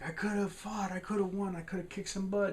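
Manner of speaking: high-pitched comic protest; imitated sore-loser bluster; mock indignant boasting. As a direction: whine it up high, as a wronged braggart. Retelling angry Adventure freed from box.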